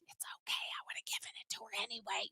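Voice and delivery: hushed, unvoiced whisper; whispered aside. Store owner privately reassuring the mom, whispered. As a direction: whisper it secretly as the kindly shopkeeper. Imagined shopkeeper's aside to mother.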